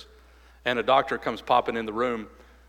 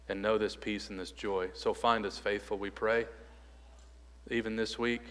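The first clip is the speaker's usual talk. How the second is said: softer, lower reverent prayer; pastoral prayer; 2 semitones lower, 3 dB quieter than usual. Acted. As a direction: pray gently on behalf of the church. Leads the closing prayer.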